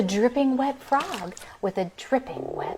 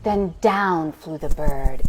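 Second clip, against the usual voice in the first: ordinary delivery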